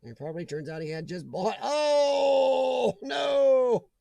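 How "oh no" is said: long, drawn-out higher wail of dismay; dismayed groan. Real, not acted. Reacting to a chatter's lost deal, dismayed groan. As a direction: let the dismay stretch out on each word. Groaning at Urbexy's bad luck.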